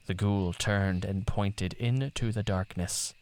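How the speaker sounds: plain reading voice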